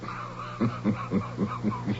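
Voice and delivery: quiet, scornful villain chuckle; scornful villain laugh. Acted. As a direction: laugh him off, softly, like a villain who has already won. Villain's triumph over emperor.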